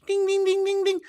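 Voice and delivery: high sung ding ding like a warning bell; alarm bell dings. Retold or imitated. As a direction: ring out each ding like an alarm going off. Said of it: "alarm bells".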